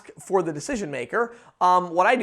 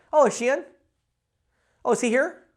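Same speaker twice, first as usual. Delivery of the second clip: quick light questions, pitched higher; real quick; 6 semitones higher than usual. Shown as a demonstration. Salesman demoing for viewers, quick and high. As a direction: breeze through it before they can think. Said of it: "Like, just real quick".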